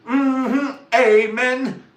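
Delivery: high, loud sanctified amen; mock preacher amen. Approving his friend, loud and high. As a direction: give a loud church amen. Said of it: "I was like".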